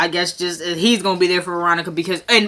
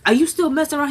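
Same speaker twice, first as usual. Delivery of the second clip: suspicious mom; 7 semitones higher, faster than usual. Retold or imitated. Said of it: "She was like".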